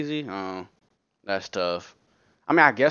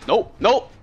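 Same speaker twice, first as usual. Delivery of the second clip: panicked; 7 semitones higher, 6 dB louder, slower than usual. Real, not acted. Panicked repeated nope.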